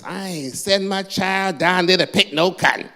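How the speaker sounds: high, loud mock parent outburst; outraged parent. Parent objecting to kids picking cotton, mock outrage. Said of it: "parents went nuts".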